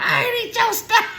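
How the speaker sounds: very high squeaky laughing denial; mock denial. Mom mimicking a guilty denial, very high. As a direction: squeak it out as a fake innocent. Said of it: "Talking about".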